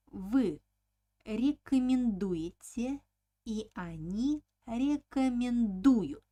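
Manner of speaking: emphatic drill, loud stressed ДУ in рекомендую and its forms; stressed suffix У. Shown as a demonstration. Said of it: "the suffix u is stressed".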